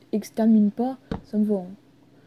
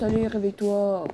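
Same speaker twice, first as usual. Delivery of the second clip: ordinary talk, nothing marked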